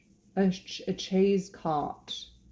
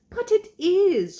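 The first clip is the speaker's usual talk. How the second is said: high, drawn-out insistence; British wife; 12 semitones higher, 7 dB louder, slower than usual. Acted. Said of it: "she returned".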